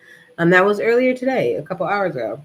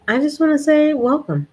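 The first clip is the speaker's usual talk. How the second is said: excited welcome; 9 semitones higher, 4 dB louder than usual. Real, not acted. Warmly welcoming viewers.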